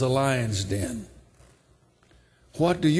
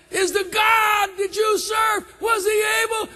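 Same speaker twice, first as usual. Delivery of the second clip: crying out; 3 dB louder than usual. Acted. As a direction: cry out desperately as the anxious king, voice pitched way up. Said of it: "And he's crying".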